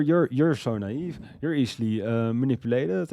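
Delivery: mild mock rapper bragging; lightly imitating the rapper. Reviewer retelling the rapper's studio brag, lightly mocking voice. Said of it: "Bus Driver was singing about".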